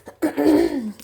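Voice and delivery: loud dry throat clearing; dry cough. Real, not acted. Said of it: "I have a cough today".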